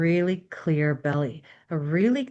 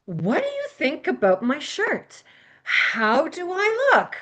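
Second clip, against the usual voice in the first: seeking approval; 8 semitones higher than usual. Acted. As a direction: ask for someone else's opinion, pitched up high. Acts out asking for approval.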